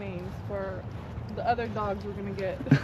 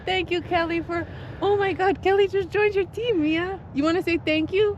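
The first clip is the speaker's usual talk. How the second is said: high, singsong delighted thanks; high-pitched, thrilled shout-out; excited gratitude; 8 semitones higher than usual. Real, not acted. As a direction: gush to the dog in a high voice. Excited thanks, talking to the dog.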